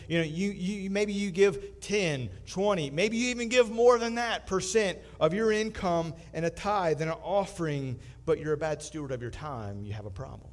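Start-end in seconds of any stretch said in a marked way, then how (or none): none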